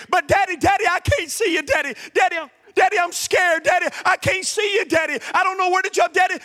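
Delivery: put-on high, pleading child's voice; little girl at the top of the stairs, afraid; scared little girl. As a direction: beg your daddy, frightened in the dark.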